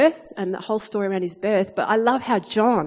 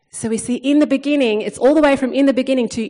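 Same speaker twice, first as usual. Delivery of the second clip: rising preaching fervour; 4 semitones higher, 5 dB louder, faster than usual. Acted. Preaching climax.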